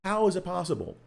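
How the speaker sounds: high-pitched, drawn-out incredulous wail; hammed-up outraged disbelief; mock exasperated disbelief. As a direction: whine it high, as if protesting terrible luck. Re-enacts freak-out over dice rolls.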